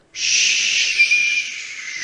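long unvoiced hiss of branches; rustling hiss. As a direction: hiss like leaves in the wind. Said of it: "susogták a borókabokrok ágai".

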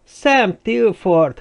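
lassan, magasan elnyújtva; elhúzva, hitetlenkedve kiáltsd; meglepett felkiáltás. Acted. Surprised greeting of lost friend.

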